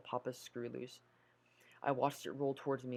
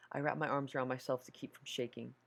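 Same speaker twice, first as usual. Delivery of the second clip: ordinary delivery, nothing marked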